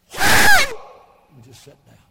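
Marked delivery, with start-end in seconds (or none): 0.1-0.8 huge high whoop; sudden shrieking whoop of joy; imitated church whoop. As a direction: let out a wild joyful whoo. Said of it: "this guy stood straight up and went".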